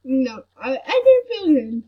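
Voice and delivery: tearful, distressed and sick; crying. Real, not acted. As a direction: cry through the words. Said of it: "I'm crying right now".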